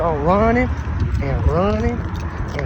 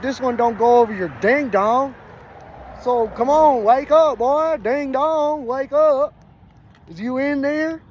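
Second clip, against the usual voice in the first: higher, louder mocking clerk; 9 semitones higher, 3 dB louder than usual. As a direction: tease him awake in a high put-on voice. Clerk voiced in a retold story.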